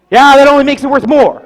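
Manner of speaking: high, loud acted comeback; emphatic retort. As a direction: correct the kid with gusto. Grandpa retorts to the boy.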